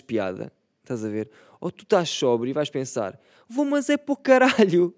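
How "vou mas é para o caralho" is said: high, loud fed-up shout; annoyed shout. Acted. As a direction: yell it like a fed-up patron. Imagined sober patron quote.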